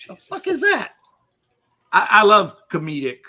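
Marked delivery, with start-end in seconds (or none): 0.1-0.9 mock shocked. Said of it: "They're just like".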